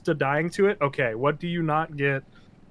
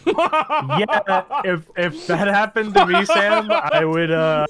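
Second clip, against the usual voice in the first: laughing while talking, higher and louder; 5 semitones higher, 6 dB louder, much slower than usual. Real, not acted. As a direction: laugh through the words in disbelief. Laughing at an outrageous raid story.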